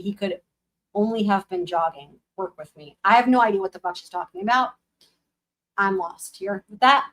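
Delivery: plain reading voice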